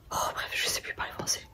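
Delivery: very soft, whispered aside; self-deprecating whisper. Real, not acted. Vlogger giving up after tripping on a word, whispering. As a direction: give up on the word under your breath.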